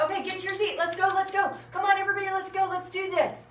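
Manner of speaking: high-pitched, urgent rallying calls; frantic. Retold or imitated. Teacher herding kids to their seats, frantic. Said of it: "Then it was frantic".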